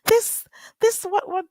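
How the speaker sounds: high, mocking whiny voice; high sneering imitation of a rival; mocking her. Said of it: "Talking about".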